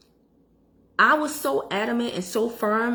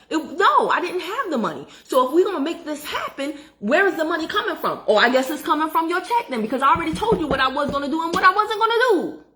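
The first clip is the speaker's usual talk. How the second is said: raised, higher, heated scolding; getting heated; 6 semitones higher than usual. Real, not acted. Telling off the real estate agent, heated and raised. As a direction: tell off the agent who crossed you. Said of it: "I'm getting heated".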